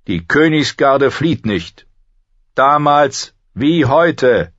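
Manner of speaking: high, ringing declaration; proud knight. Acted. Kingsguard knight declaring loyalty to Ned, proudly defiant. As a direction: declare the creed high and loud.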